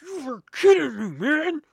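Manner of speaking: loud, high, fed-up outburst; exasperated yell. Retold or imitated. At the spilled drink, a loud exasperated yell. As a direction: blurt it out like the drink just soaked you.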